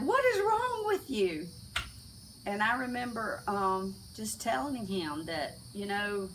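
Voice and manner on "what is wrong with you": high, slow, put-on voice of a baffled husband; exasperated husband. Husband watching her beat the phone, asking her, high and slow. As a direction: play the stunned husband pitching up and drawing it out.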